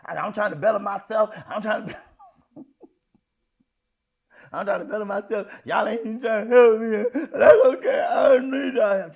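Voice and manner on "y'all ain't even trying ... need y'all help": loud, high, crying outburst; emotional outburst. Retold or imitated. Daughter wailing at her parents, loud and tearful. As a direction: wail it like a hurt child. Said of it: "all that emotionalism".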